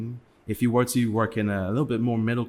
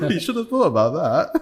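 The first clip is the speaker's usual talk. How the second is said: laughing through the words, pitched up and loud; laughing retort; 12 semitones higher, 9 dB louder, much faster than usual. Retold or imitated. Teasing friends who forgot they're abroad, laughing. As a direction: crack up while teasing them. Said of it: "And I'm like".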